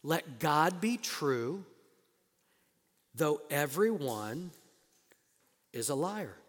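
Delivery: very slow, weighted let God be true; slow deliberate reading. Shown as a demonstration. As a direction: lay each word down slowly. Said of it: "do you?".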